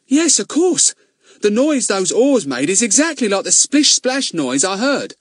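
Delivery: light up as it clicks; eager boy. Acted. Ern voiced, realising.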